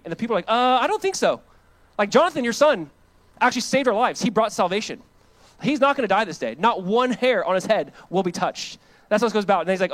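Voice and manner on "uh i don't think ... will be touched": mock protest. People retold pushing back on Saul.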